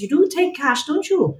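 high, sing-song imitation of a Welsh woman; bad Welsh accent. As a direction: put on a clumsy Welsh accent, pitched high. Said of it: "excuse my bad Welsh accent".

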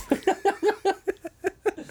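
laughter climbing to a high squeal. Real, not acted. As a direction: break into shrill, helpless laughter. Laughing at the film's joke.

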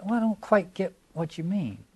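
high, hesitant polite puzzlement; mildly mock-innocent; mild evasive denial. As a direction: dodge it gently, feigning confusion. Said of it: "but see how mild that is".

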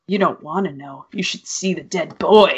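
low, soft spooky voice; creepy reply. Pretend. Imagined creepy reply to kid.

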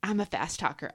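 normal talking voice

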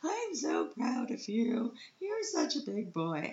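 high, slow tender praise. Retold or imitated. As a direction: coo it to your son slowly. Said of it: "and I was like".

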